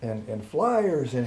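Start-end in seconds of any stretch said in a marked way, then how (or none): none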